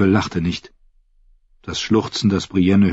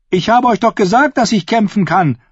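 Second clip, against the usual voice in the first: put-on high, raised boyish call; 13 semitones higher, 4 dB louder than usual. A narrator's reading. Podrick calls to Brienne from the wall, high and loud. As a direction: shout it proudly across to her. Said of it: "rief er ihr zu".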